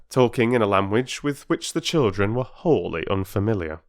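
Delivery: usual speaking voice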